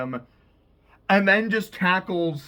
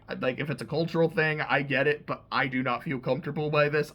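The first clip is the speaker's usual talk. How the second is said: ordinary talk, nothing marked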